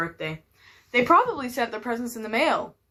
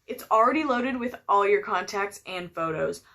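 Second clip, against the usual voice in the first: ordinary talk, nothing marked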